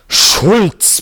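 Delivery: roared name, high and loud; roaring. A narrator's reading. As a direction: roar the name at him. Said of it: "brüllte er".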